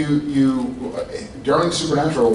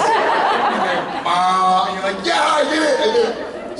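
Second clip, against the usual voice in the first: put-on kid's excitement; 8 semitones higher, slower than usual. Retelling kid's trucker horn joy.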